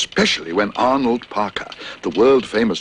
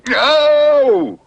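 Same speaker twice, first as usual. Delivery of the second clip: high, loud yelp of pain; dentist's thumb bitten, yelling in pain; 7 dB louder than usual. Acted. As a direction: yell as the bear bites your thumb. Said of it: "yelled Mister Leach".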